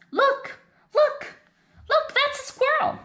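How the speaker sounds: very high, eager squeal of look; excited pet-talk. Acted. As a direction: point it out like to an excited puppy. Dog points out a squirrel.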